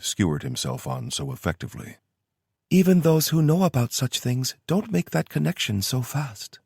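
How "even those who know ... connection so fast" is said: put-on higher, kind young woman's voice; kindly Kyoto. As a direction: speak gently, like reassuring a friend. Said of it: "she said kindly".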